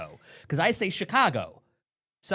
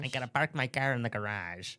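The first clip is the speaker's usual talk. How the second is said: softer, low Buffalo garage; mock Buffalo accent; 3 semitones lower, 4 dB quieter than usual. Host imitating Russ in a lightly put-on Buffalo accent. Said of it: "Russ says garage".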